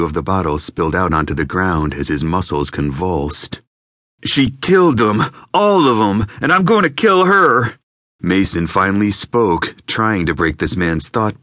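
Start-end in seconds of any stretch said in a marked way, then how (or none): 4.2-7.8 high, loud, rising into a threat; raving man swearing revenge; unhinged rant. Acted. Unhinged gunman rants.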